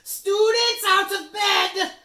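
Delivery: huge, high, slow bellow. Acted. Peeves bellows to alert the castle, very loud. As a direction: raise the whole castle. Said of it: "Peeves bellowed".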